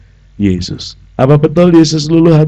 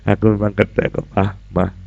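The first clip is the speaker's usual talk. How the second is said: gentle meek answer; 4 semitones lower, 8 dB quieter than usual. Acted. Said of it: "gentle answer again".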